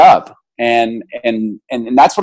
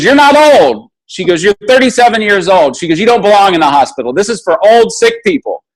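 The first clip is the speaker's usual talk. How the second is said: scolding nurse; 7 semitones higher than usual. Retold or imitated. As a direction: tell him off like a strict nurse.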